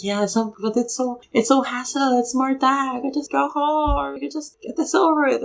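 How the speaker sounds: high, whiny don't-care voice; lazy debater voice. Retold or imitated. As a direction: whine it like a lazy trainee. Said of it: "And I was like".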